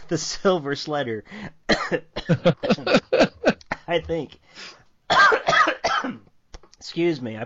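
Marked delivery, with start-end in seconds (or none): 1.3-3.8 harsh choking cough; coughing fit. Real, not acted. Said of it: "it went down the wrong pipe". 5.1-6.2 sharp strained cough; coughing fit. Real, not acted. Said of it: "it went down the wrong pipe".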